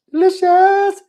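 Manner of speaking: very high, loud, held-out delight; gushing squeal. Real, not acted. Host gushing about how a game looks, high excited squeal. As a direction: squeal it with relish.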